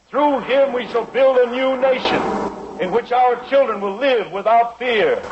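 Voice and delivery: slow, high, grand speech delivery; solemn oration. Acted. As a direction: proclaim it like a founding prophecy. Solemn patriotic oration opening.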